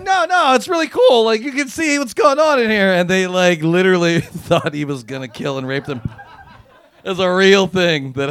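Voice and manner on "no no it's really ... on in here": high, eager coaxing; coaxing friend. Retold or imitated. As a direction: lure them in with a high eager sell. Dan coaxing people inside.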